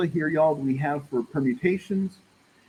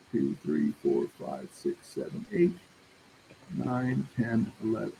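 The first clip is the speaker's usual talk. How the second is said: quiet counting; 4 semitones lower, 7 dB quieter than usual. Real, not acted. Counting spaces under breath.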